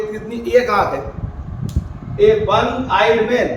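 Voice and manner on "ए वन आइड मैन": slow and deliberate, word by word; slow model phrase. Shown as a demonstration. Teacher reading the example to students, slowly. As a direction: say ए वन आइड मैन slowly, as a model. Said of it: "मैं आपको लिख के दिखाता हूँ".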